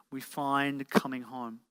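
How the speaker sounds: ordinary talk, nothing marked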